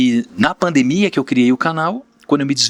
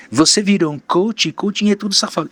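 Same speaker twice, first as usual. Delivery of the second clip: higher-pitched mock accuser; quoted critic in a raised pitch; imagined critic; 8 semitones higher than usual. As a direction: imitate someone's dismissive jab, pitched up. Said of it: "a pessoa fala assim".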